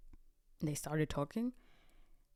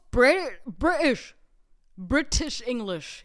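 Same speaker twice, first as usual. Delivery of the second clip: mock posh British accent, louder; 3 semitones higher, 10 dB louder, slower than usual. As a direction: exaggerate a British "British", loud and crisp. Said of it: "It was British English".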